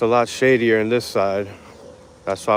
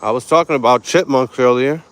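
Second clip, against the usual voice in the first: usual speaking voice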